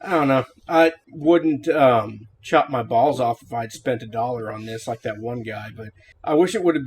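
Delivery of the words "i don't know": usual speaking voice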